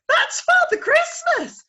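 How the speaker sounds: high, loud excited squeal; shriek. Acted. Owlet shrieking at Father Christmas. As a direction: burst out in delight.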